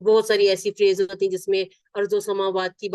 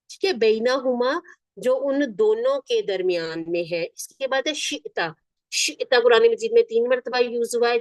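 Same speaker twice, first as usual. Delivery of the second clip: ordinary delivery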